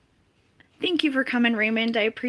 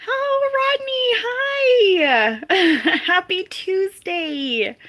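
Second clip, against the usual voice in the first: drawn-out, much higher and louder call of the name; excited greeting; 9 semitones higher, 5 dB louder, much slower than usual. Real, not acted. Streamer greeting a returning viewer with a long, delighted call.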